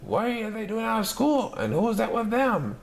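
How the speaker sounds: put-on a cranky old lady demanding answers; scratchy voice. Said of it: "she said in a scratchy voice".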